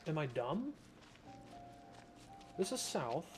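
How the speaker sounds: ordinary talk, nothing marked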